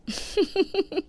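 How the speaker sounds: high sly villain cackle; gloating laugh. Acted. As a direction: laugh at your own trick. Mother-in-law gloats over plan. (बहू के खिलाफ चाल पर ऊँची कुटिल हँसी)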